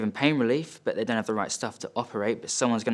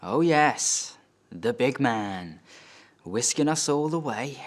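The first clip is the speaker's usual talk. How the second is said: sarcastic, lilting swing up in pitch; mocking dad; 3 semitones higher, slower than usual. Dad to son who booked the family trip, mocking. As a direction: play a dad scoffing at his son's generosity. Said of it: "He says".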